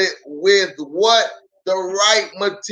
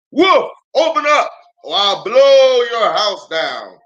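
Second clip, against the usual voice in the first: higher, loud wolf voice; wolf's threat; 5 semitones higher, 4 dB louder than usual. Acted. Wolf shouting at the second pig to open up. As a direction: bellow the threat through the stick door.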